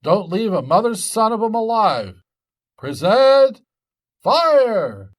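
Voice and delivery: yelling fire at the top of the voice; shouted orders. Acted. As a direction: shout it to charge the attack. Jack shouting battle orders.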